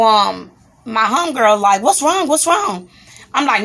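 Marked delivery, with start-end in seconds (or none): 1.8-2.9 high-pitched worried questioning, imitated; alarmed friend. Homegirl asking after the outburst, imitated in high alarm. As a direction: voice a friend's urgent concern, pitched up.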